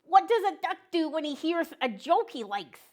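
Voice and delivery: high, cheerful cartoon duck voice; a chirpy little duck saying hello to kids; friendly duck puppet. Duck puppet telling joke.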